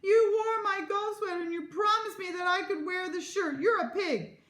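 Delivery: high-pitched wailing complaint; hammed-up tearful accusation; crying tantrum. A narrator's reading. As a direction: wail it like a sobbing child. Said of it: "cry and sob".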